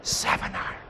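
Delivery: whispered, very soft; a tiny voice in the head, barely audible; little inner voice. Acted. Intuition whispering to the golfer over the shot, little inner voice. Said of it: "a little voice goes".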